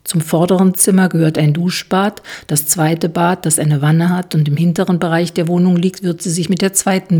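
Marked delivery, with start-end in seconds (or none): none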